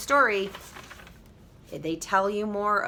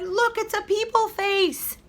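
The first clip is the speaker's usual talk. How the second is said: delighted mother; 12 semitones higher than usual. Acted. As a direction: marvel at the baby like a happy mom. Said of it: "and said".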